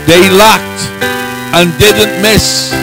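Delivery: ordinary talk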